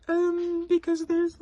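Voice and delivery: very high, breathy, hesitant falsetto with long pauses; exaggerated polite coworker. Shown as a demonstration. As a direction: squeak it out as an over-apologetic colleague. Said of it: "There was the timing... eyes closed".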